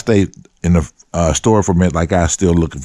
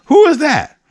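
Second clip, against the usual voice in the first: startled call; 4 dB louder than usual. Retold or imitated. Calling toward the bush.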